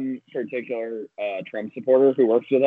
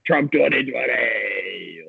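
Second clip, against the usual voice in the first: slow, drawn-out chant of a slogan; a hammed-up rioter chanting slowly; mock rioter; much slower than usual. Mock rioter chanting at a random Starbucks. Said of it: "be like".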